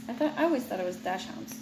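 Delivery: quick, quiet self-correction; fast, quiet aside to herself. Real, not acted. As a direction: hurry through it under your breath. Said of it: "I don't know how to say it".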